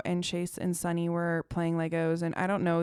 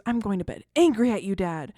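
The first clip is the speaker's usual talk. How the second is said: mock-angry child, a little louder; mad child; 6 dB louder than usual. Retelling daughter's bedtime outburst at dad, mock mad child. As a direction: imitate an upset kid's declaration. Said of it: "She's, like, mad".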